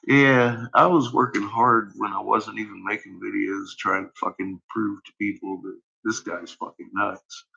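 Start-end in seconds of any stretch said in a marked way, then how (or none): none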